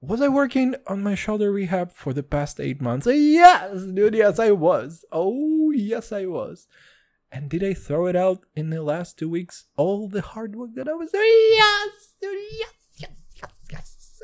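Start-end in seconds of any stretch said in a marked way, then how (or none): 3.0-6.6 high, loud comic outburst; hammed-up, high-pitched shouting; emphatic shouted yes. Loudly answering his own question. 11.5-14.2 high yell dying away to unvoiced; hammed-up yes, shrinking into a whisper; shout fading out. Answering himself again, voice fading away.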